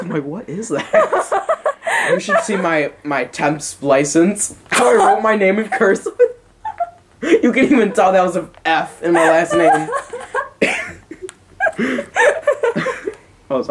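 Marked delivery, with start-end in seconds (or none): 0.2-2.1 high-pitched, drawn-out laughing question; laughing disbelief. Real, not acted. Looking back at his own writing, laughing in disbelief. 6.2-12.1 words breaking up with laughter; laughing hard. Real, not acted. Mocking his own cursive to his friend, laughing hard.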